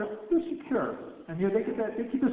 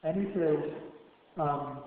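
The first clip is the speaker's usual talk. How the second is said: usual speaking voice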